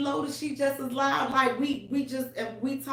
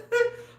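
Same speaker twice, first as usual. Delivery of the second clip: high laugh. Real, not acted. Laughing telling hospital story.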